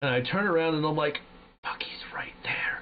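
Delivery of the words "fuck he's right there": breathless, much softer whisper, slowed; retold whisper. Spotting the enemy at the window, terrified whisper to teammate. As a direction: hiss it in terror, barely voiced. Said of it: "We're whispering in Discord".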